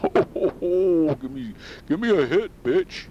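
high rough Santa voice; stoned Santa begging. Acted.

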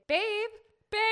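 high, loud questioning call. Retold or imitated. Camper calling to missing boyfriend from tent, searching call. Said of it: "I call out, and I say".

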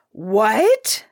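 the king explodes at the refusal; furious king crying out; raging cry. Acted. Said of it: "cried the king in a rage".

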